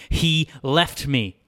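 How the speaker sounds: loud, slow, high outburst; screamed protest. A narrator's reading. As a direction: cry out each word slowly, hurt and angry. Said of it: "screamed Amy".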